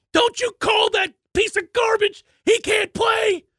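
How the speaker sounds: tackling the caller in a rage; mock-furious yell; furious shout. Grabbing the phone caller.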